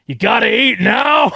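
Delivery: high, slow, booming jab at the eater; mock Vince shout. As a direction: bellow it like a wrestling promo. Vince leans in, mocking Lorne.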